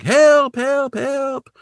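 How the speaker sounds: very high, drawn-out calls for help; hammed-up high-pitched distress call; mock cry for help. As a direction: imitate someone dangling and yelling for rescue. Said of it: "he's crying for help".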